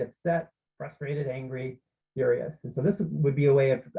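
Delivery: ordinary delivery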